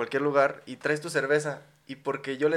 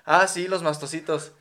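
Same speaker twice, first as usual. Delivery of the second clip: out loud; 3 semitones higher, 6 dB louder than usual. Retold or imitated. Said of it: "dijo así en voz alta".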